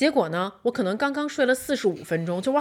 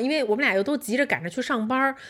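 normal talking voice

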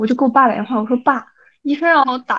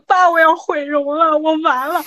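higher, wailing to dad in mock despair; imitated crying; 4 semitones higher than usual. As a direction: act out a tearful phone call. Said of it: "整个过程我在哭". (模仿哭着喊爸)

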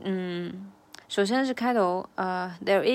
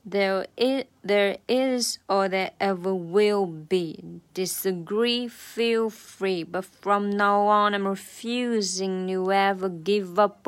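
slow, a little louder practice reading; sing-read each phrase slowly; slow demo of the verse; slow run-through; 4 dB louder, slower than usual. Shown as a demonstration. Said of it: "我们再来慢速的过一遍哈".

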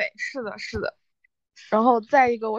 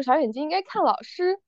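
higher-pitched classroom answer; imitated schoolchild reply, lifted in pitch; child answering teacher; 5 semitones higher than usual. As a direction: recite it back like an eager first-grader. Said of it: "然后我说".

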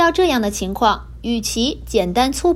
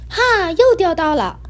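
high, louder gleeful shout; happy cry; 8 semitones higher, 4 dB louder than usual. A narrator's reading. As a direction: crow with delight at the catch. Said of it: "黑猫开心地说".

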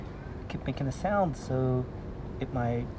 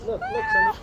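excited. Real, not acted. Excited at cat eating.

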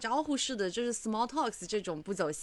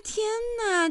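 much higher, amazed; mock amazed gasp; 12 semitones higher than usual. Coworker sees her makeup, amazed high gasp. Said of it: "他说".

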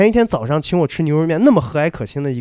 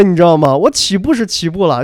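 normal talking voice

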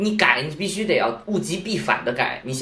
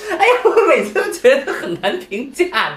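laughing; 10 semitones higher, 4 dB louder than usual. Real, not acted. Laughing at own metaphor.

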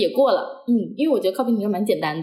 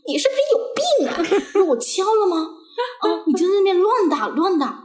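mock angry scolding; 10 semitones higher than usual. Instructor berates the learner for tapping wrongly, in a mock scolding. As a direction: mimic the instructor tearing into a student. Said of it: "夹着那个他的大嗓门， 呱呱呱， 那一直骂我。".

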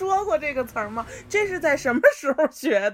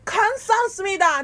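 Korean-style accent; 9 semitones higher, 5 dB louder than usual. Shown as a demonstration. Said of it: "就是那种感觉".

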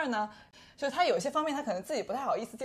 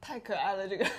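can't stop laughing. Real, not acted. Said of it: "现在[name]已经笑得停不下来了".

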